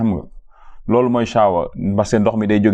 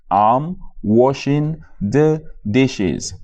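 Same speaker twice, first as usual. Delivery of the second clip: slow model phrase; much slower than usual. Shown as a demonstration. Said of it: "teacher models the phrase".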